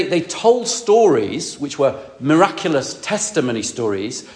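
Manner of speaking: ordinary talk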